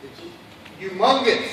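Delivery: echoing in the big bay; words ringing off the walls; hallway echo. Real, not acted. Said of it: "as you can hear by the echo".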